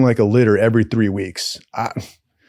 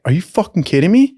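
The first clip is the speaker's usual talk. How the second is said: retold disbelief; 7 semitones higher than usual. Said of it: "everybody was like".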